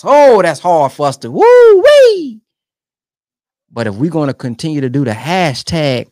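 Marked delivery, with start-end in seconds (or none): 1.3-2.4 very high loud woo-wee; a soaring exclamation of awe; wowed woo-wee; woo-wee exclaim. Real, not acted. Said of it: "that's hard on us".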